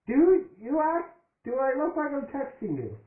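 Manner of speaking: high-pitched, incredulous comeback; indignant retort. Acted. Character accused of texting, indignant retort.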